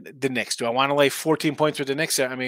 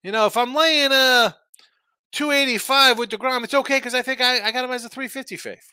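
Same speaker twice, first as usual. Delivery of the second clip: lightly mocked bettor, pitched up; higher-voiced imitation of guys justifying a bad bet; imitated bettors; 10 semitones higher, 6 dB louder than usual. Host quoting guys in the betting biz, lightly mocking. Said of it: "They say".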